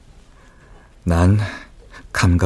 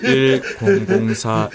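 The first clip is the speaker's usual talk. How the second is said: laughing; much slower than usual. Shown as a demonstration. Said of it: "잘 안 되네".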